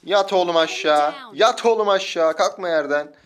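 loud, stretched-out commands; shouted orders. Real, not acted. Gamer ordering an opponent to stay down, shouted. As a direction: yell them down and keep them down.

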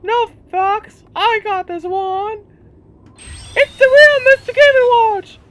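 very high put-on cocky voice; squeaky character. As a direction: claim the spot in a shrill cartoon voice. Character voice in game.

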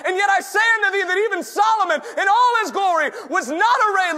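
loud shouted sermon delivery; full-force preaching. Acted. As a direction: preach it out at the top of your voice.

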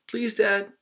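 high pleading please to his dad; a boy pleading with his dad to go to the woods one last time. Retold or imitated. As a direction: beg like a kid asking one last favor. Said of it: "I pleaded".

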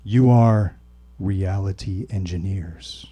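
slow, weighty pronouncement dropping low; grave. A narrator's reading. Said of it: "he said gravely".

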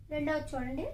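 usual speaking voice

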